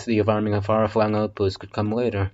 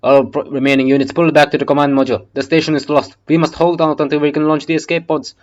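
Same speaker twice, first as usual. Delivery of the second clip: loud, higher commander shouting over the radio; urgent radio order; 6 semitones higher, 9 dB louder than usual. Acted. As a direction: bark the retreat like a captain under fire. Captain radios retreat order.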